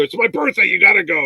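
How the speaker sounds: retold emphatic nagging; insistent pleading. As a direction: press a reluctant partner to come. Retold insisting to reluctant wife.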